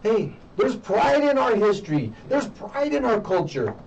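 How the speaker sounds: high, emphatic call for pride; rallying proud declaration. Acted. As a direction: proclaim it like a rallying cry. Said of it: "to express".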